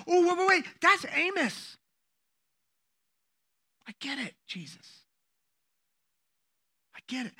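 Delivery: high, loud, startled interruption; sudden realization. Acted. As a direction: blurt out an acted discovery. Acted sudden realization of young leader.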